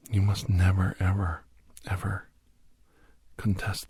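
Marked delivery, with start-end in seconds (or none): none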